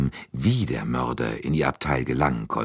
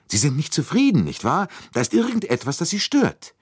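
higher-pitched probing question from a colleague; concerned questioner; 13 semitones higher, 3 dB louder than usual. A narrator's reading. Monsieur Bouc to an unsatisfied detective, concerned probing. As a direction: press a friend who seems troubled.

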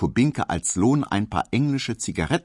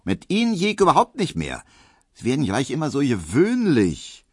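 pointed; 7 semitones higher than usual. A narrator's reading. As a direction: put him in his place. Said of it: "sagte Hedwig spitz".

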